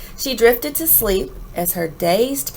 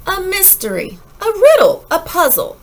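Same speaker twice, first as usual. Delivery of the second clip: building excitement; 9 semitones higher, 7 dB louder than usual. A narrator's reading.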